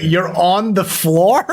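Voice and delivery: high, drawn-out incredulous question; amused disbelief. Real, not acted. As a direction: stretch it out in surprised disbelief. Just learned co-host is on floor.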